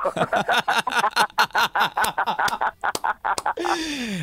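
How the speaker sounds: long high laugh. Real, not acted. [name] laughs at his own punchline, a long high laugh.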